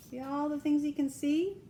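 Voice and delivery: plain reading voice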